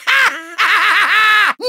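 triumphant gloating laugh; evil cackle. Acted. Villain who found the helm, triumphant cackle. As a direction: cackle over your prize.

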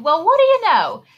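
ordinary delivery, nothing marked

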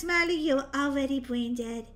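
ordinary talk

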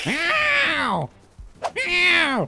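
high, long cat yowl; drawn-out high-pitched meow; yelling meow. Pretend. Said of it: "I'm just kinda yelling at him".

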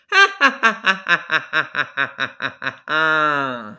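loud hammed-up villain cackle; gleeful wicked laugh; evil cackle. Villain laugh after plan.

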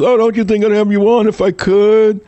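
high, mock sugary fake apology; mocking her excuse. Mocking the Fox host who shut him out, high mock-sweet. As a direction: simper the excuse back at her in a falsetto. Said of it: "The one who said she'd have me on".

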